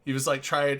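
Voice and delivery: ordinary talk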